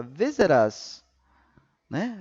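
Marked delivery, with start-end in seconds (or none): 0.0-1.0 visit us linked as "vizerus", consoante ligada à vogal. Shown as a demonstration. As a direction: run "visit" into "us" as one word. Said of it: "peguei essa consoante com a vogal e transformei ela numa segunda palavra". ("visit us" ligado, como uma palavra só)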